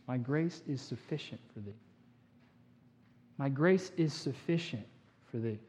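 hushed, steady reassurance from God; calm divine reply. Acted. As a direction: answer the plea quietly and gently. God's gentle answer, voiced.

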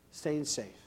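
"Staying safe." soft and low, echoing; quiet echo. Real, not acted. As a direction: murmur "Staying safe." back softly.

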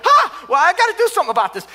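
sudden realisation the oven is on fire, in a panic; panicked. Retold or imitated. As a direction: blurt it out in a rush of alarm. Sees brownies on fire.

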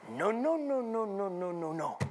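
put-on child's sing-song noes sliding down; playful mimicry of a three-year-old chanting; imitating his little son. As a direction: start high, fade as it descends. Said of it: "and I heard him say".

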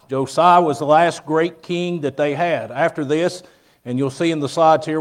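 ordinary talk

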